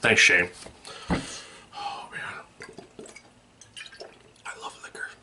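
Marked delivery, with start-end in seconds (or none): 1.1-5.1 breathy whispered aside. Acted. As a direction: whisper it lovingly to yourself. Whispered aside after sign-off.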